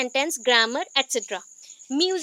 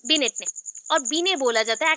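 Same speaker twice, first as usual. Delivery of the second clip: usual speaking voice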